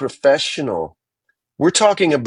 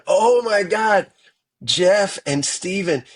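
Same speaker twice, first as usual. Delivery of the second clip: higher, slow, amazed exclamation; stunned retelling; 7 semitones higher than usual. Retold or imitated. [name] reliving hearing the lineup, awestruck. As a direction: relive the jaw-drop at the news. Retold reaction to star lineup.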